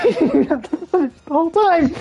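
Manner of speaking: amused, breaking up on the words; laughing while talking. Real, not acted. Storyteller repeats the footage punchline, laughing.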